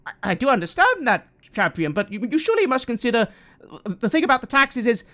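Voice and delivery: flustered priest. Acted. GM voices the priest.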